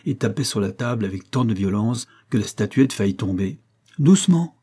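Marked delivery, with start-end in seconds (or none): 4.0-4.6 high, loud interjection; exclaimed caution. A narrator's reading. Bouvard checks his friend pounding the table, exclaiming high and loud. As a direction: cut in sharply with a raised voice.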